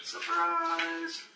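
high teasing Surprise; sing-song gotcha; mock surprise. GM repeats trap call.

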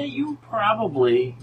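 ordinary delivery

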